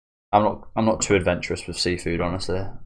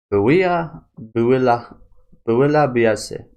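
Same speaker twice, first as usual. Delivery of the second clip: hammed-up French accent, higher and louder, drawn out; mock French accent; 5 semitones higher, 5 dB louder, much slower than usual. Reading a French dish name aloud, in a mock French accent. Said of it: "Don't know why I put that accent on".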